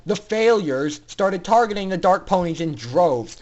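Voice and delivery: ordinary delivery, nothing marked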